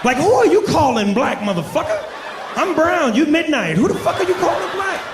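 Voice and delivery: high-pitched mock indignation; outraged comeback. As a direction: squeal it in hammed-up outrage.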